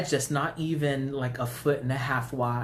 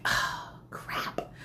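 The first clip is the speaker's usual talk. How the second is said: whispered "uh-oh" dread; dismayed whisper. Retold or imitated. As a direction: curse under your breath. Dismayed reaction to cat, retold.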